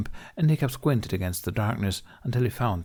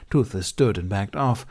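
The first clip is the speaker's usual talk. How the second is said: plain reading voice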